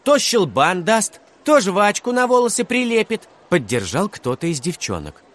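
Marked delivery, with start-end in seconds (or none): 0.0-3.3 high, loud list of grievances; indignant child calling out; shouted accusations. A narrator's reading. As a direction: rattle off his crimes, climbing higher. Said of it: "снова выкрикнул".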